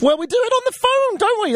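high, sing-song aside to listeners. Acted. Radio host teasing listeners, high sing-song voice. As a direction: lift it way up, playful and singsong.

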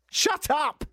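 sudden high-pitched yell; exasperated high cry cutting off the chatter; exasperated outburst. Acted. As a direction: snap it out, high and fed up. Fed-up outburst at the mimicked talker.

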